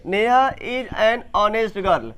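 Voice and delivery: very slow, every word drawn out; teacher dictating the sentence slowly; slow dictation. Shown as a demonstration. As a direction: read it for the class to copy. Reads sentence again for class.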